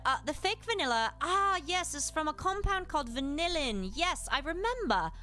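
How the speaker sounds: normal talking voice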